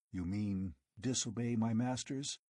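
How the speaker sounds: usual speaking voice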